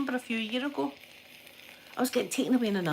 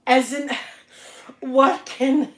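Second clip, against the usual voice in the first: slow, drawn-out, louder words round the mouthpiece; through gas and air; 4 semitones higher, 6 dB louder, much slower than usual. Retold or imitated. She shows how she spoke on gas and air in labour, slow and loud. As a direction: drag each word as if high on gas. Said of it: "I was doing that".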